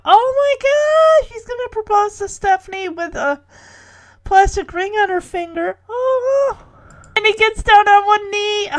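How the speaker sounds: high-pitched real thrill; excited gushing. Real, not acted. Host watching Jimmy propose to Stephanie, thrilled gushing. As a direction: squeal your way through a sweet surprise.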